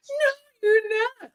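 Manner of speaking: very high, whiny pleading reassurance; mock reassurance. People reassuring a self-pitying friend, high whiny mock reassurance. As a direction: coo like fishing for pity. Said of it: "so that people are like".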